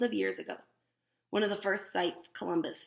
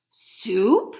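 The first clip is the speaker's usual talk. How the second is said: surprised; 10 dB louder than usual. Real, not acted. Surprised at popcorn soup.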